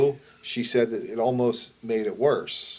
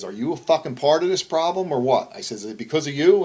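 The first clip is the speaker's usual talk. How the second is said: higher, louder retold yell at the girl; angry yelling; 5 semitones higher, 6 dB louder than usual. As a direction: confront her hard, voice raised. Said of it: "I'm yelling at him".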